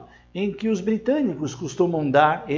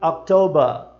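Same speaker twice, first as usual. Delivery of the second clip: October ending in /ɐ/, falling; October britânico; British October; slower than usual. Shown as a demonstration. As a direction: drop the R of October. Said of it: "Percebe a diferença?".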